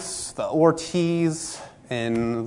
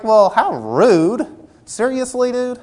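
mock offended; 6 semitones higher, 5 dB louder, slower than usual. Offended quote in hallway story.

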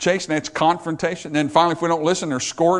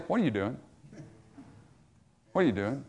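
low-key, softer voice of God; quiet confronting question, left to hang; 3 semitones lower, 9 dB quieter, faster than usual. Acted. Preacher as God catching someone out, quiet and pointed. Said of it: "had God come along and say".

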